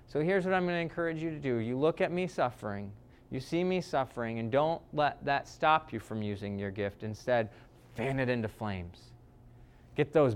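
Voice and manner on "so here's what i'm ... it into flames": much softer, close and earnest; hushed plea. Retold or imitated. Paul quietly urging Timothy, soft and intimate. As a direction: lean in and urge it gently.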